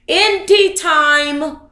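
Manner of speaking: ordinary talk, nothing marked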